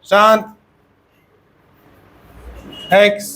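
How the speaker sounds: slow drill, each word said alone with long pauses; roll-call drill. Shown as a demonstration. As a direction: call the prefixes out one by one for the class to repeat. Drills the class in chanting the prefixes. (कक्षा से एक-एक शब्द दोहरवाना, रुक-रुककर)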